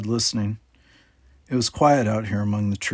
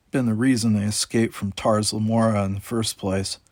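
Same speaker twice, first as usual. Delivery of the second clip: ordinary delivery, nothing marked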